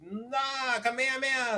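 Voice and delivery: high-pitched mock anime battle yell at contained volume; insane anime cry. Reviewer showing what the show wasn't like, mock anime yell. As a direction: parody an over-the-top anime attack call. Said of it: "it wasn't like that".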